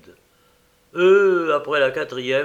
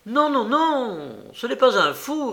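high, insistent denial in a character voice; a lightly voiced peremptory objection; 9 semitones higher than usual. A narrator's reading. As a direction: cut them off firmly, like it's settled. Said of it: "répliqua péremptoirement Makoko".

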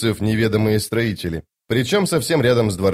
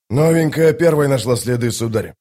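soldier reporting to commander. Acted. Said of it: "сказал всадник правого крыла".

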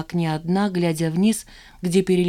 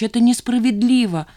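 higher, louder outburst; blurted out; 6 semitones higher, 5 dB louder than usual. A narrator's reading. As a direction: let it burst out in protest. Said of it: "вырвалось у неё".

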